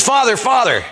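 high, eager call for attention; urgent calling. Retold or imitated. Kid running up to the priest after the talk, calling urgently. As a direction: call out as you run up.

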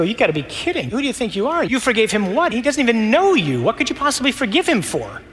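high, indignant mock disbelief; scoffing scribes. Scribes scoffing at Jesus forgiving sins, high indignant disbelief. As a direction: scoff at him as an upstart.

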